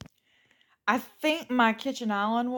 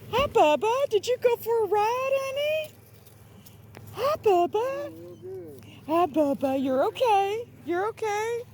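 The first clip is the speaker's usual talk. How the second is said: excited pet greeting; 12 semitones higher, 3 dB louder than usual. Real, not acted. Welcoming Bubba back from his ride, very high excited baby talk. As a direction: greet the dog with high delight.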